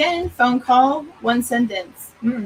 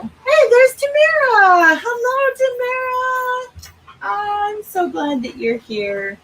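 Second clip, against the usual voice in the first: much higher, slow, delighted hello; excited greeting; 5 dB louder, slower than usual. Real, not acted. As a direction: sing out a welcome. Greeting arriving viewer.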